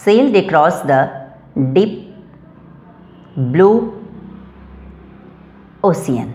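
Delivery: each adjective set apart with long pauses; word by word with pauses. Shown as a demonstration. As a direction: lay out the corrected order one word at a time. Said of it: "तो अभी आप देख रहे हैं यहाँ". (हर शब्द के बीच रुककर धीरे-धीरे पढ़ें)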